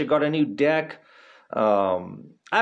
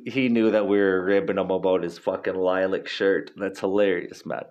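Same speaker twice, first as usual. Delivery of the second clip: usual speaking voice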